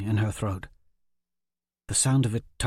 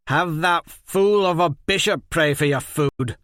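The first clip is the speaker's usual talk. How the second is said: louder, higher mocking jab; taunting jeer; 7 semitones higher, 6 dB louder than usual. Jenko mocks Liz Hen, louder sneering taunt. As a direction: sneer it at her.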